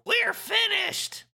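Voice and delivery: squawky high duck voice, drawn out; Donald Duck voice. Acted. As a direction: quack it out like Donald. Donald voice reading game script.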